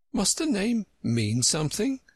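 high, doubtful little question. A narrator's reading. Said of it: "Alice asked doubtfully".

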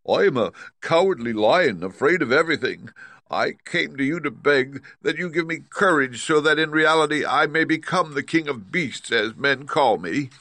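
high, loud lion voice; pleading Cowardly Lion. Acted. Lion begging Oz for courage, high and pleading.

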